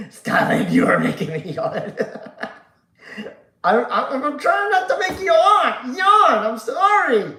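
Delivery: giggling, loud and high; laughing. Real, not acted. Host cracking up at chat making him yawn, laughing loud. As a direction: laugh your way through it, can't stop. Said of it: "made me laugh".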